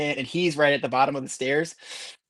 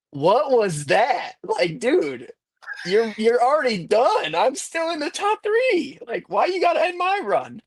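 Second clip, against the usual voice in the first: retold indignant rant; 7 semitones higher, slower than usual. Retold complaint after being crashed.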